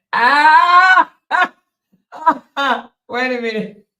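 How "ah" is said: loud, high shriek of amusement; amused shriek. Real, not acted. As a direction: shriek as the joke hits you. Real outburst at viewers' funny names.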